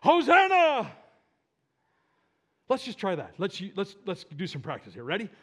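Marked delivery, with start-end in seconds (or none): 0.0-0.9 building from preaching into a loud, high shout; crowd shout. Acted. Preacher voicing the crowd greeting the king, building to a shout. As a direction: raise it to a full shout on the last word. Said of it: "people shouting together".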